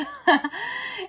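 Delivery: bright, high burst of laughter at herself; giggling at her own accent. Real, not acted.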